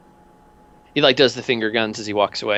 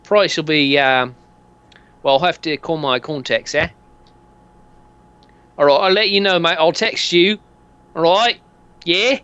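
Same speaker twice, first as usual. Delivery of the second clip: higher, chatty geezer pitch; dodgy dealer; 5 semitones higher than usual. Acted. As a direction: sell it like a dodgy mate. Dealer closing deal.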